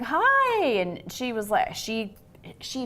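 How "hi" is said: very high, bright and loud hello; bright greeting. Retold or imitated. Wife opening door to mother-in-law, high bright hello. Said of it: "I opened the door and I was like".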